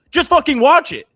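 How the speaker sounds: high, loud yell; imitating a worked-up outburst; mock exasperated shout. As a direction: yell it at a friend who won't listen. Imitates cohost's group chat outburst.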